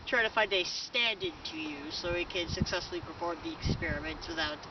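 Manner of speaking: ordinary talk, nothing marked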